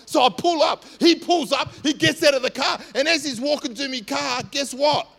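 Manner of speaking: loud, high storytelling at full force; excited building shout. Real, not acted. Preacher building the confrontation to the congregation, loud and excited. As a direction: drive the tension up loudly. Climax of road rage story.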